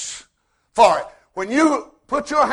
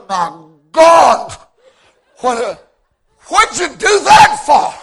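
louder, higher outcry through laughter; pained yell; 5 semitones higher, 9 dB louder than usual. Retold or imitated. Husband hit on the nose yells at wife, laughing. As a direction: yell it like you just got hit.

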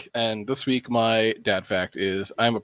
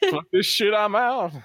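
mock dad exit; 7 semitones higher than usual. Voicing the absent dad leaving.

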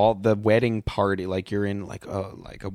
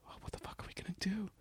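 fast, frantic whisper; panicked whisper; 9 semitones higher, 19 dB quieter, much faster than usual. Retold or imitated. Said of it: "And you're like".

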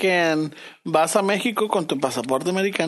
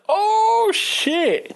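shocked exclamation; 13 semitones higher than usual. Retold or imitated. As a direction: blurt it out in shock. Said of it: "I'm like, pop".

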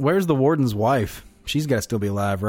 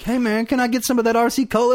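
pitched up silly prisoner; high goofy inmate voice; 12 semitones higher than usual. Retold or imitated. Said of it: "he's like".

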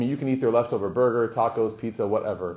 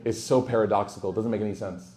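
usual speaking voice

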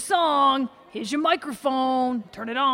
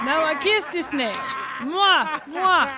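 gushing diva; 4 semitones higher, 4 dB louder than usual. Acted. Posing, kissing the snake urn.

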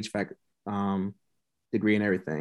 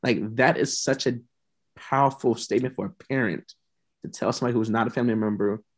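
usual speaking voice

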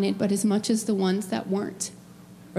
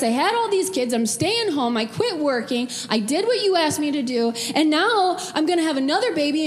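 pleading complaint, pitched much higher; overwhelmed wail to God; 9 semitones higher than usual. Retold or imitated. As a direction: cry out to God, overwhelmed and begging. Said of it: "I was like".

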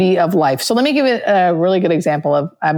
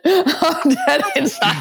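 laughing joke; 7 semitones higher, slower than usual. Real, not acted. Joking self-mockery, laughing.

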